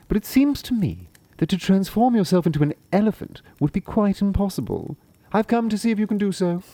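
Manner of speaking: high, flattering cat voice; bow and butter him up, high; a high, polite cat flattering the sorcerer. Acted. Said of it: "The cat bowed politely and said".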